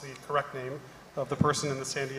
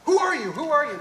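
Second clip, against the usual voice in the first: much higher, louder repeated demand; confused demand; 12 semitones higher, 7 dB louder than usual. Retold or imitated. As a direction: squeak it out, bewildered and insistent. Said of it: "she goes".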